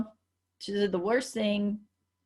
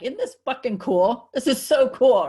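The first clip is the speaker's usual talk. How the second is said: higher and louder, gushing with excitement; excited gushing; 4 semitones higher, 6 dB louder than usual. Retold or imitated. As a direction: rave like it's the coolest thing ever. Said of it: "I was so excited about it".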